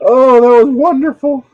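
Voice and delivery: loud, high, savoring sigh; gleeful delight. Real, not acted. Remembering scaring that guy, loud delight. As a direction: relish it on a long opening oh.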